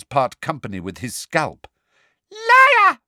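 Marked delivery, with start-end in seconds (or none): 2.3-3.0 very high, loud shriek; furious shout. A narrator's reading. Umbridge screams liar at Harry, furious. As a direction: scream it at him. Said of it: "shouted Umbridge".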